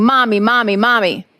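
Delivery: ordinary talk, nothing marked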